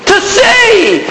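high, urgent called-out answer; acted high plea from the beggar; beggar crying out. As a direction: cry out the one desperate wish. Blind beggar answers Jesus, crying out.

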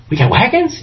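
high, incredulous question; surprised brothers. Acted. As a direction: ask in high disbelief about the wagons.

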